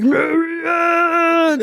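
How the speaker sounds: high, stretched mock-spooky name call; drawn-out taunting call. Haunt actor trapping her in a corner, taunting drawn-out call. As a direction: tease her with a long, creepy call. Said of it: "he used to be like".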